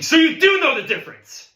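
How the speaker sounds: high, loud explosion of anger; bellowing bandleader; furious shout. Acted. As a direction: yell it in the student's face. Teacher explodes at drummer.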